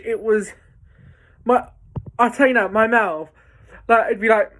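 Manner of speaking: usual speaking voice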